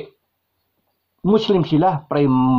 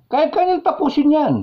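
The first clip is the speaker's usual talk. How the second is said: emphatic insistence; 14 semitones higher than usual. Real, not acted. Repeats that a general could end it.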